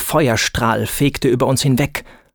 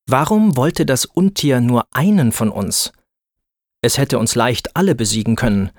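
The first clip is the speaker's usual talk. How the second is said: usual speaking voice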